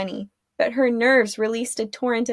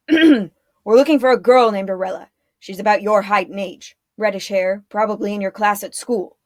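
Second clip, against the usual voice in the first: gruff guard; 5 dB louder than usual. A narrator's reading. Guard questions children.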